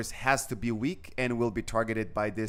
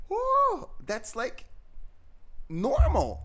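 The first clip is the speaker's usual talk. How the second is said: much higher, unimpressed woman's voice; imitating the hygienist; slower than usual. Retelling the Russian hygienist's reaction, high imitation. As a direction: mimic her shrugging it off, pitched way up. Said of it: "she was like".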